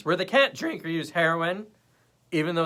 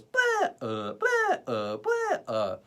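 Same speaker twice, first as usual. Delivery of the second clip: sing-song blah; 11 semitones higher, 5 dB louder, much slower than usual. Shown as a demonstration. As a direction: voice swooping way up then down. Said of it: "my voice naturally goes".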